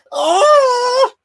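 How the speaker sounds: very high loud drawn-out oh; ecstatic cry. Real, not acted. Hearing of new episodes, ecstatic high oh. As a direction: squeal with joy.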